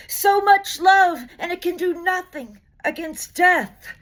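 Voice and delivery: high, loud, slow cry of the crowd; pleading shout. Acted. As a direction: call it out as if thousands beg at once.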